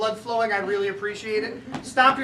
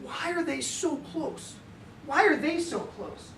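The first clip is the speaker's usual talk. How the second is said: put-on high, slow, softer child's voice; questioning young son; 5 semitones higher, 5 dB quieter, slower than usual. Son asking dad about grandma and brother, imitated slow questioning. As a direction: imitate a little boy puzzling it out.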